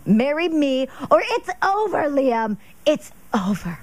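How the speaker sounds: a hint of an insistent girlfriend. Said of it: "I think that's somehow how it went".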